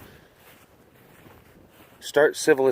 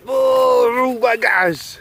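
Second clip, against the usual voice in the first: real thrilled oh my gosh, higher and louder; excited gasp; 12 semitones higher, 7 dB louder, much slower than usual. Real, not acted. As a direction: burst out in delight. Excited reaction to tiger.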